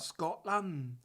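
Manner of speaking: put-on Scottish accent. Shown as a demonstration. Showing co-host the accent is Scottish, Scottish accent. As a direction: say it like a Scot. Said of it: "it's a bit Scottish".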